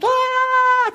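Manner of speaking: put-on high, loud joyful child's scream; a kid screaming with delight at good news. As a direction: let out a high happy child's shriek. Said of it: "A început".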